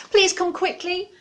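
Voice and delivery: high, loud pleading squirrel; urgent squirrel. Acted. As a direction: beg for help in a hurry. Squirrel begs owl.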